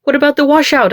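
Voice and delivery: high, loud cry of alarm. A narrator's reading. Passenger to driver racing at a barrier, crying out. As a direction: cry out urgently, pitch jumping up. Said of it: "I cry".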